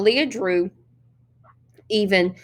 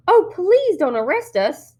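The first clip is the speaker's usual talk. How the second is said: put-on high, slow, sugary little girl plea; little girl pleading with policemen; sweet childish pleading; 10 semitones higher, 3 dB louder, slower than usual. A narrator's reading. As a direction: play the innocent small child begging. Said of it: "her little girl voice sounding even more sweet and childish".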